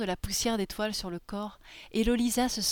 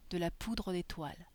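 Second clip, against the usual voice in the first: usual speaking voice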